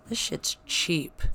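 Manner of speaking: ordinary talk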